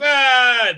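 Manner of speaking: loud, high "aaah" yell; mock falling scream. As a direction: scream as if shoved off a cliff, hammed up. Imitating being pushed off a cliff.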